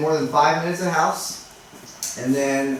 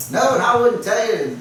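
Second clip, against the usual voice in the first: a hint of a curt, higher homeowner; rude homeowner; 7 semitones higher than usual. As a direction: brush off the realtor, mildly mocking.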